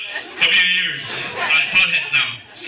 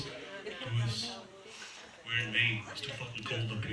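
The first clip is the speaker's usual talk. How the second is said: softer, mock sister complaining; sister's complaint; 6 semitones lower, 14 dB quieter, slower than usual. Sister grumbling to her brothers, softer mock complaint. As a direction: play the sister grumbling at the cold. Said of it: "my sister goes".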